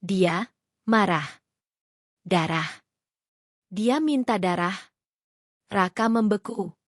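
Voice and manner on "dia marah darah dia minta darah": a hint of a spirit speaking through her, slowed down; heavy hoarse spirit voice. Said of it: "mengubah suara berat dan serak".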